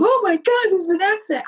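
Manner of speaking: high, hammed-up panicked cry; mock panic. Driver falling into her old victim trope, in mock panic. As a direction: act the old helpless victim, overplayed. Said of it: "fallen into the trope of".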